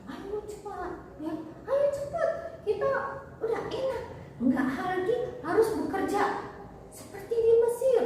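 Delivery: high, excited hurrying call; acted eager urging. Israelites leaving Egypt urge each other on, eager. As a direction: hurry the group along in a lifted voice.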